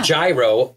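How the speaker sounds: "gyro" said JAI-roh. Shown as a demonstration. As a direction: open "gyro" on a J, rhyming with "tyro". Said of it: "people say it all different ways".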